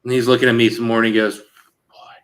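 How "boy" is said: a breathy retold whisper of disbelief; whispered "boy". Tailor sizing up the customer's build, whispering in disbelief. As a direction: hush it like a stunned aside.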